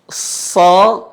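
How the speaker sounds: so said heavy and rounded, a thick sad letter. Shown as a demonstration. Teacher demonstrates heavy letters to students. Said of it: "tapi mirip huruf O".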